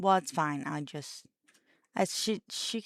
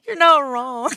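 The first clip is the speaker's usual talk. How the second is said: laughing; 7 semitones higher, 14 dB louder than usual. Real, not acted. Laughing hard at joke.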